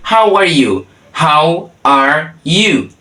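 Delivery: very slow, each word held apart; slow demonstration. Shown as a demonstration. Teacher shows learners how to ask how someone is, slow demonstration. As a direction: spell it out word by word.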